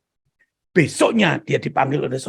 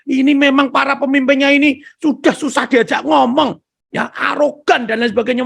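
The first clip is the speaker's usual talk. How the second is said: angry complaint; 8 semitones higher, 4 dB louder than usual. Retold or imitated.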